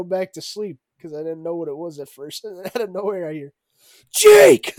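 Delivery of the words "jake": high, very loud shout of a name; imitating a sudden piercing call. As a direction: yell it to wake a sleeper. Call repeated, no longer quiet.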